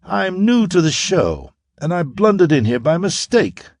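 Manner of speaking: Otho's voice. A narrator's reading. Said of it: "assumed annoyance".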